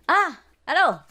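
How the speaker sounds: put-on high, laughing drunk buddy voice; mock tipsy soldier calling his pal. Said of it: "So he say".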